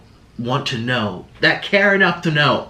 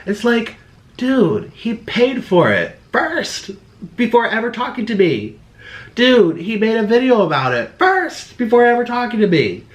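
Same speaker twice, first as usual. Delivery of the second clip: exasperated rant; 9 semitones higher, 3 dB louder than usual. Real, not acted. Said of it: "venting at commenters".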